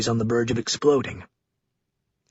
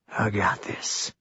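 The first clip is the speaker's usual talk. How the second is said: soft, slow murmur under the breath; murmured softly; 6 semitones lower, 8 dB quieter, slower than usual. A narrator's reading. Philip Blake alone with the prisoner, softly murmuring. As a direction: say it almost only to himself. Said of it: "Very softly under his breath, Philip Blake murmurs".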